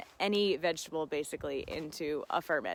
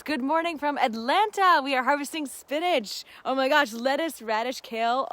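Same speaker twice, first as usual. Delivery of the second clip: excited greeting; 6 semitones higher, 6 dB louder than usual. Retold or imitated. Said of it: "And Madhuri says".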